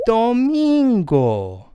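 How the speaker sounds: domingo high and slow, do-MIIN-go; Spanish pronunciation demo. Shown as a demonstration. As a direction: draw out domingo, high then falling away. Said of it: "Sunday is".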